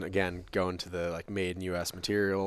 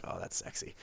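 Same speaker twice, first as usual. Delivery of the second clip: whispered; 10 dB quieter than usual. Retold or imitated. Awed reaction quote.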